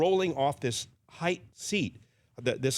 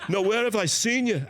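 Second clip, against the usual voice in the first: playful celebrity voice; 9 semitones higher, 4 dB louder than usual. Retold or imitated. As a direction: lean into a curious rock star's voice. [name] voice retold.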